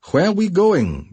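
high, insistent demand. A narrator's reading. Captive demands to know where they are being marched, high and insistent. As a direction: demand an answer, pitch jumping up. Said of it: "demanded Raleigh".

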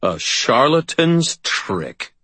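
slow, high-pitched indignation; angry disdain. A narrator's reading. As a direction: draw it out in regal anger. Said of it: "Anger began to pour off of him".

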